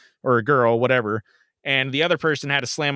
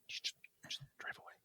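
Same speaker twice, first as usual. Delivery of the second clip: whisper; 27 dB quieter, much faster than usual. Retold or imitated. Lady urging him to leave.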